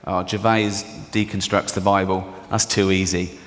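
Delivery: a hint of a snooty critic; dismissive critic. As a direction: brush it off like a bored critic. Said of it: "One critic ... said".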